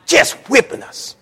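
ordinary talk

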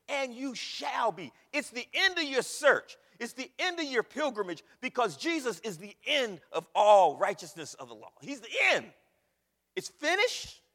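loud, high, slow climactic preaching; preaching at full force. Acted. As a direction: build to the climax and shout it home.

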